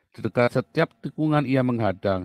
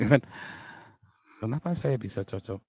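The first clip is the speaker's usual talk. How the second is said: usual speaking voice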